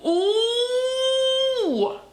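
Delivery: usual speaking voice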